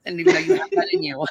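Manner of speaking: mock amazed. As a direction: gasp it out in disbelief. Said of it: "You're like".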